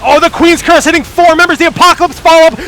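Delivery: high, loud, full-force esports call; excited play-by-play. Real, not acted. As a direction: ride the team fight at peak excitement. Live call of team fight.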